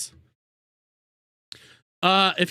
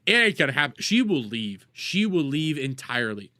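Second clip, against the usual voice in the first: ordinary talk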